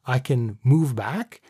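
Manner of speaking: normal talking voice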